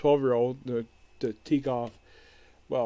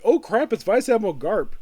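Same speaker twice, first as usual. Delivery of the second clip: higher, louder yelp of alarm; mock startled panic; 6 semitones higher, 8 dB louder than usual. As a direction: blurt it like you've just been caught. Startled pirate recognizes Garp.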